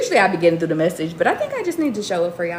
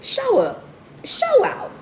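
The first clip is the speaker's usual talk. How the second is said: excited self pep talk; slower than usual. Retold or imitated. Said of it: "And I be like".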